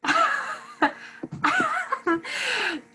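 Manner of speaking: long high-pitched laugh; real surprised guffaw; burst of laughter. Real, not acted. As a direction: crack up laughing, high and helpless. Laughs at the casting.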